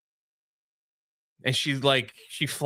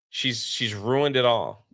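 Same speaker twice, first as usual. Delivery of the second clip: normal talking voice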